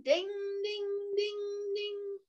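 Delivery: high bell imitation, dying off; train bell. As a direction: ring like a bell, fading away.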